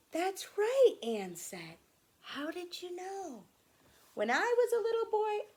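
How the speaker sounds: bright, fast and high; excited voice. Acted. Anne and Grandfather excitedly talking, high voice.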